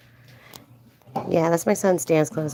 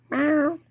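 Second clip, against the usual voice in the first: high, short mock cat meow; imitated meow; 10 semitones higher than usual. Cat answering the Egyptian.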